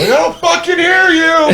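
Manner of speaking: high, loud screaming bark; drill instructor scream. Retold or imitated. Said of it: "he would scream it out".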